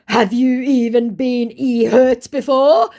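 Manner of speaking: high, slow, loud put-on voice; mock scammer voice. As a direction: try a guilt trip slowly. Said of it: "he tries".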